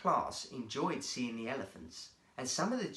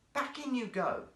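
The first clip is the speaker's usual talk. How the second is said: lightly voiced keeper; 10 semitones higher than usual. A narrator's reading. Keeper ordering seals.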